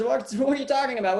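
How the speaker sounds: quick, laughing disbelief; a merchant laughing off his servant's prank; incredulous laugh. Acted. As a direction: laugh it off as a bad joke. Merchant baffled his clothes gone.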